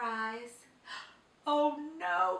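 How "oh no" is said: usual speaking voice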